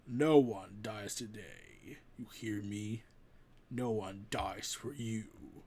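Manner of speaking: Thor's hushed, deep vow to the Devourer; low solemn vow. Acted. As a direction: quietly swear it like a king. Thor vowing to protect the people.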